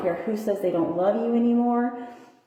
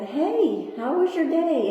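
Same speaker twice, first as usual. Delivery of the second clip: high, friendly retold hello; imitated bright, caring check-in; cheerful greeting; 7 semitones higher, slower than usual. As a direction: greet her in a lifted sing-song pitch.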